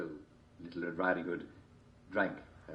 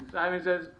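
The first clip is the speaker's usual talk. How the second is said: game host call; 11 semitones higher, 4 dB louder than usual. Acted. Game host calling out commands.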